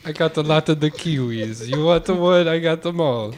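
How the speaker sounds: put-on failed New Zealand accent drifting Jamaican. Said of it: "Oh, we went back to Jamaica".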